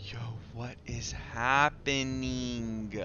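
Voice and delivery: drawn-out, very slow dazed question; slow bewilderment. Real, not acted. Player lost in the game's interlude, slow bewilderment. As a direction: stretch the words out, especially happening.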